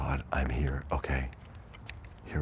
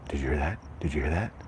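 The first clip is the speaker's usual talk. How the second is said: usual speaking voice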